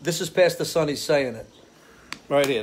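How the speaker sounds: ordinary talk, nothing marked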